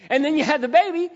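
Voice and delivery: put-on rising preacher's payoff on baby; excited. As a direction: announce the baby like a triumph. Climax of birth story.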